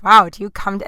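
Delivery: ordinary talk, nothing marked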